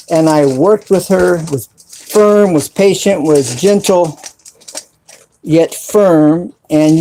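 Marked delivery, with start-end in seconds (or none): none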